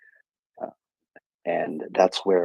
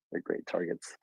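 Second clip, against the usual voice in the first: breathy whispered repeat; whispered aside; 10 dB quieter than usual. Real, not acted. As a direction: drop to a whisper for the echo. Repeats line, then whispers it.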